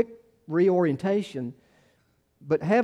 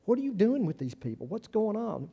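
higher, mock-scandalized church elder; indignant authorities; 5 semitones higher, 3 dB quieter than usual. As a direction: demand it like a shocked religious friend.

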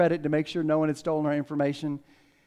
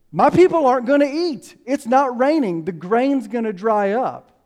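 high, loud, urgent worry; acting out a king alarmed for his people; raised voice, anxious outburst, easing slightly; 7 semitones higher, 6 dB louder than usual. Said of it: "David is anxious because".

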